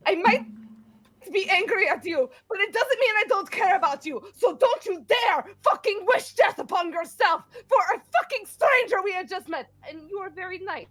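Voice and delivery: high, loud pleading shout; angry tearful plea. Acted. Grendel shouting at Emrys that she cares, high and loud. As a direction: yell that you care, near tears. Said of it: "she is just like".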